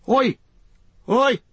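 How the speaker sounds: high, loud cry of "Oi!"; sudden shout. A narrator's reading. Pippin calling out at the oppressive trees, high sudden shout. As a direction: yell out as if you can't bear it. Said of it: "let out a shout".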